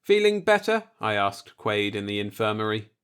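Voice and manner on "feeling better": higher, brighter check-in; concerned question. Acted. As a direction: ask the patient gently but lifted. Visiting patient in infirmary.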